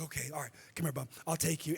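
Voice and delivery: put-on soft, tender dad talking to his little boy; gentle dad voice. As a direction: coax him along gently and quietly. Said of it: "my son gets the most nurturing side of me".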